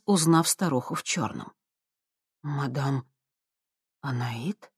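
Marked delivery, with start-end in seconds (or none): 2.4-4.7 soft, hushed gasp of recognition; barely audible. Acted. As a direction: say it barely above a breath. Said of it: "едва слышно произнесла она".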